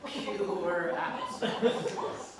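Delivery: usual speaking voice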